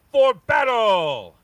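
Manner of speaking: rising to a loud, high, slow shout; rally for battle, building to a roar; battle cry. Acted. He-Man shouts Battle Cat into battle, building to a roar. Hero calls his mount to fight.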